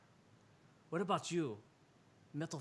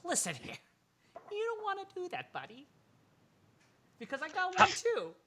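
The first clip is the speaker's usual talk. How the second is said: put-on much higher tough girl voice; young girl voice; 8 dB louder than usual. Npc girl threatening a man with a grenade, high and tough. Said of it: "young girl just goes".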